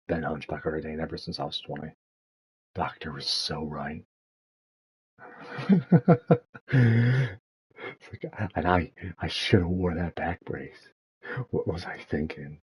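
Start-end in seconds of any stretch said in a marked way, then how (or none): 5.2-7.4 loud laugh at yourself; rueful loud laugh. Real, not acted. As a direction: laugh at your own misfortune. Laughs at own hunchback.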